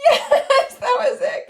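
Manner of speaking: high, loud, laughing praise; excited praise. Real, not acted. Praising child's right answer.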